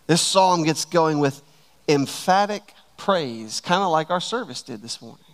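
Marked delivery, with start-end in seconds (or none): none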